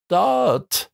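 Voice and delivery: high-pitched cry without much volume; called out. A narrator's reading. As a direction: call out the word high and drawn, in a storyteller's way. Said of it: "rief Williams".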